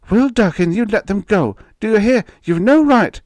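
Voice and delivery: high, loud, commanding wife; indignant wife. A narrator's reading. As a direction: order him to let the children go. Wife orders husband to release children.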